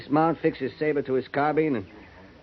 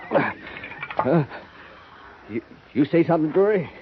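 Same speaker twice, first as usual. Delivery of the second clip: quick, flustered, higher questioning; startled; 5 semitones higher than usual. Acted. Soldier jolted from his memory answering Drury, startled. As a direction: snap back to the present, caught off guard.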